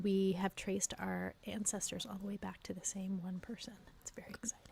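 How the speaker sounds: hushed conspiratorial whisper. Acted. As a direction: lean in and whisper it fast. Secret shared about family ancestors.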